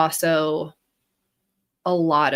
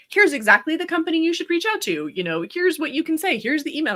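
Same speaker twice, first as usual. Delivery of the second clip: high, fast helpful assistant; eager chatbot; 9 semitones higher, faster than usual. Retold or imitated. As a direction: rattle it off like a keen assistant.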